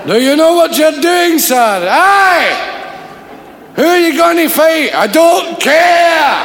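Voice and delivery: high, loud shouted exchange; loud Glasgow circus shouting. Acted. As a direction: bellow across the tent.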